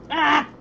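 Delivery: sharp cry of pain. Real, not acted. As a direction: yelp from a burn.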